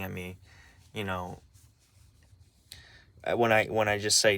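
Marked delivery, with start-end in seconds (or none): none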